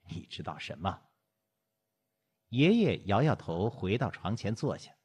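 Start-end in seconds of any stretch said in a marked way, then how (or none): 0.1-1.0 low, soft dismissal; a hint of a weary old man; lightly voiced grandfather. Grandfather brushing off his wife, low and quiet.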